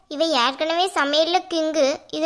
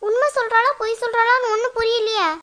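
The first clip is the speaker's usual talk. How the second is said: alarmed confusion; 7 semitones higher than usual. Acted.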